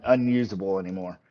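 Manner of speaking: ordinary talk, nothing marked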